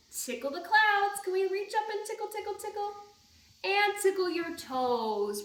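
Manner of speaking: high, loud, bouncy leading of a movement game; cheerful story-time leader calling out actions; action rhyme. Acted. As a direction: lead the kids through the moves with big energy. Leads a tickle action rhyme.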